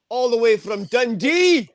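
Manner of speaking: high, rising hype-man announcement; stage announcer introduction. Real, not acted. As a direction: announce the act to a cheering room. Host introduces comedian onto stage.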